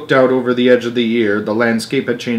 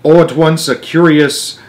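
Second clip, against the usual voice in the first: ordinary delivery, nothing marked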